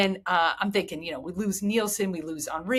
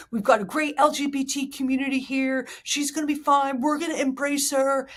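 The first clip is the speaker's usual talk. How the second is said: put-on higher voice, held steady; Houston fan voice; 6 semitones higher than usual. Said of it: "this is the voice of Houston".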